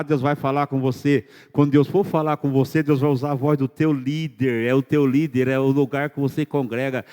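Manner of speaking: ordinary talk, nothing marked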